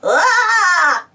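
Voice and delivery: high, loud acted scream; frightened cry. A narrator's reading. Spud startled by the owl, screaming. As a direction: yell in cartoon fright. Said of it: "Spud cried".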